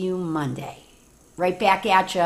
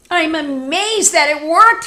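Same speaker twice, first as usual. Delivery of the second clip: higher, louder wolf marvelling; amazed wolf; 8 semitones higher, 9 dB louder than usual. Acted. Wolf marvels at blowing down the house, higher and louder. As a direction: sound stunned at your own success. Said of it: "he said".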